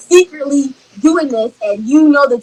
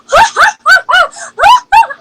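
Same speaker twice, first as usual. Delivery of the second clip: high, loud imitation of a manic laugh; crazy laugh; 5 dB louder than usual. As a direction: cackle wildly, mimicking him laughing like crazy. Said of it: "He's laughing. He's like".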